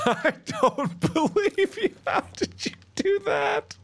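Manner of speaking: very high, laughing yelp of disbelief. Real, not acted. Laughing at friend's Animal Crossing injury, high incredulous squeal. Friend claims dislocated thumb.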